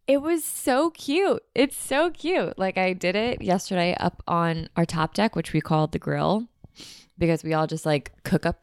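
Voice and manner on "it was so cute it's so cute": gushing. Real, not acted. Gushing over the gift.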